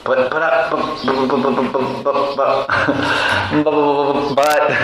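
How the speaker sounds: a devotee stuck on but, stammering. Acted.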